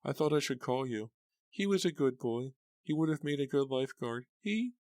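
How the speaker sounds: high, tearful lament; crying. A narrator's reading. Mother mourning her son, crying. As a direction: weep over her lost boy. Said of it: "starting to cry".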